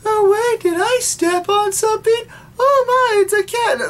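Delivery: mock the dumb new Serena, whining high; mock ditzy Serena. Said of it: "steps on Luna and goes".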